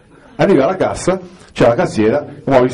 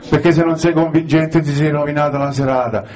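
normal talking voice

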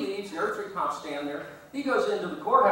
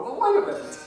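mock innocent; 13 semitones higher, 4 dB louder, faster than usual. Mock-innocent objection to the cop.

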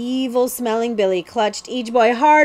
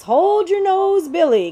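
higher, louder, slow proclamation; joyous outburst; 10 semitones higher, 5 dB louder than usual. Acted. Cutwater joyously hails Billy as a prince, loud and high. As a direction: crown him with glee. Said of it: "Cutwater burst out joyously".

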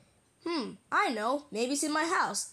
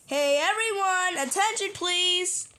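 raised pretend announcer call; calling for attention; 5 semitones higher, 3 dB louder than usual. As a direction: call the whole group to order. [name] calls the group together.

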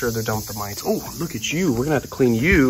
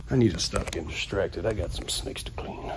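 unvoiced whispered aside; 5 semitones lower, 8 dB quieter than usual. Real, not acted. Keeper whispering a reminder to himself, whispered. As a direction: whisper it to yourself, refocusing. Aside to camera while working.

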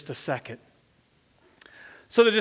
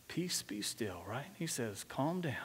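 much softer and lower, utterly unruffled; calm command; 8 semitones lower, 17 dB quieter than usual. Retold or imitated. Jesus speaks softly to the wind and waves, calm. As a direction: still the storm with a hushed voice.

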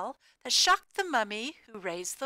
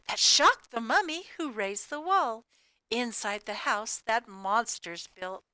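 ordinary delivery, nothing marked

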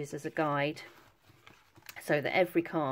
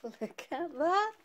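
delighted; 11 semitones higher, much slower than usual. Real, not acted. Admiring the printed umbrella.

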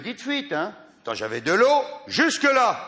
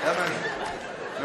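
laughing at granny; 3 semitones lower, 6 dB quieter, much slower than usual. Real, not acted. Teasing granny, slow laugh.

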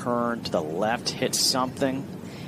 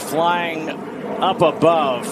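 raised, slow, building birdie call; golf commentator; 6 semitones higher, 4 dB louder, slower than usual. Acted. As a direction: lift it into a highlight call. Calling a birdie.

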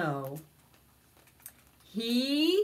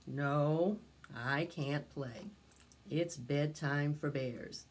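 lower, softer bear voice; a drowsy bear turning them down; sleepy bear; 5 semitones lower, 5 dB quieter than usual. Acted. Sleepy Bear refuses his friends, lower and soft.

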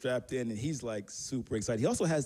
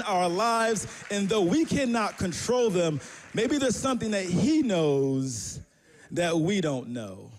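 louder, slower preaching swell; 5 semitones higher, 5 dB louder, slower than usual. Acted. Pastor driving home his point to the church, slow and loud. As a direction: lean in and preach it to the congregation.

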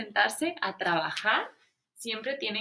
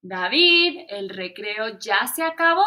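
teacher calling out; 7 dB louder than usual. Acted. Teacher calls David in.

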